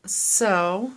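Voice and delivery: said through a yawn. Real, not acted. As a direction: yawn while speaking. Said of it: "Sorry for the yawn".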